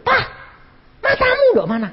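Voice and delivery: high, loud scold at husband; wife scolding her husband, high and loud. Retold or imitated. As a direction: snap at him like a cross wife. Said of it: "Malah dimaki-maki".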